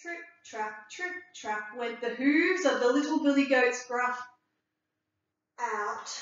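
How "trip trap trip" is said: plain reading voice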